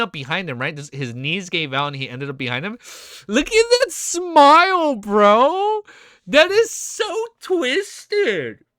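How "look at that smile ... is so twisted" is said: high, loud, drawn-out amazement; stunned awe. Real, not acted. Streamer seeing a twisted smile, stunned awe. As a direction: stretch each phrase out, pitched way up.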